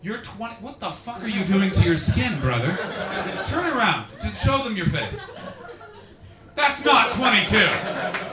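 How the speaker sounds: loud, high, incredulous ribbing; mock outrage. Comedian teasing a young audience member, loud mock outrage. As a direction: exclaim in exaggerated disbelief.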